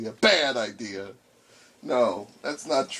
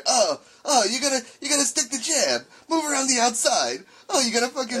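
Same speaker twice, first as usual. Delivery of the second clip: put-on high-pitched old-time boxing coach; impression of boxing trainer; 9 semitones higher than usual. Friend mocking the other's impression of him, boxing trainer voice. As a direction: coach the fight in a hammed-up high voice. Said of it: "it's just my voice for you".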